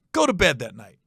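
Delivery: usual speaking voice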